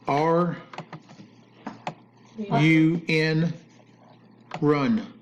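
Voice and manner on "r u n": letter by letter, R U N; spelling words out. Shown as a demonstration. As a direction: say R, U, N as separate letters. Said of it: "Why are you spelling out words?".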